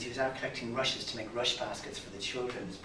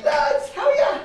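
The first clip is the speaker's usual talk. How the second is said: loud cheerful greeting; 14 dB louder, slower than usual. Acted. Brian bursting into the pub.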